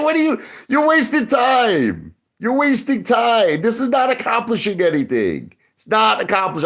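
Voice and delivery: high, stretched-out exasperated cry; worked-up, high-pitched complaint; exasperated scolding. Retold or imitated. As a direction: berate them at a high pitch.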